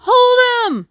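high, loud drawn-out cry. A narrator's reading. Crowd rushing at the fluttering shirt, crying out. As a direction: shout it out as a whole crowd would. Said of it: "cried everyone".

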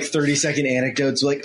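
usual speaking voice

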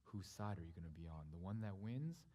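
low, soft, weighty delivery; solemn preaching. Acted. Preacher reveals the story's meaning to church, solemn. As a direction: let each statement settle quietly.

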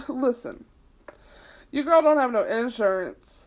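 usual speaking voice

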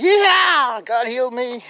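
high, loud whooping celebration; jubilant cheer. Retold or imitated. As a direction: whoop it out as the healed man. Healed man's celebration, acted out.

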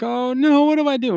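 high panicky whine; mock panic as Morty; panicked Morty. Retelling Morty cornered by cops, high mock panic.

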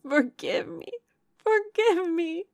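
high, loud, climbing plea; distraught pleading. A narrator's reading. Remorseful sister throwing her arms round Eleanor's neck, distraught pleading. Marianne begs sister's forgiveness.

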